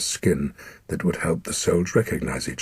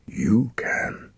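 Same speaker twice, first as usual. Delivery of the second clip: dying whisper; 5 semitones higher than usual. Acted.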